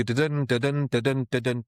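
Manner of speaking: "da-dun" chanted with the beat on "dun"; iambic rhythm. Shown as a demonstration. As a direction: tap out the iambs: "da-dun, da-dun". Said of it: "these runs where you go".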